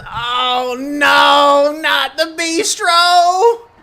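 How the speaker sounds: high, drawn-out wailing cry; loud groan. Acted. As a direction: groan it out at full volume, stretching the words. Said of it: "you hear a loud groan".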